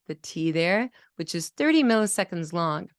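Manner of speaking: ordinary talk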